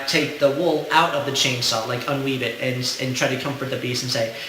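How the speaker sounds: ordinary talk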